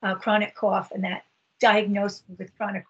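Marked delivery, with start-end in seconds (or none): none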